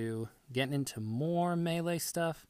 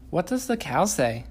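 sing-song parent voice; 4 semitones higher, 7 dB louder than usual. Real, not acted. Parent quizzing toddler on animals.

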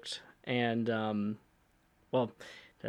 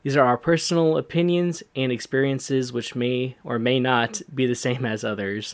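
disclaimer read; 7 dB louder than usual. Acted. Reads podcast disclaimer intro.

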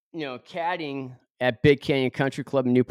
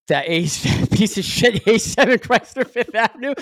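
laughing through it, much higher, mocking his own beat-up car; laughing self-mockery; 11 semitones higher, 6 dB louder than usual. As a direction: spit out the car name in embarrassed laughter.

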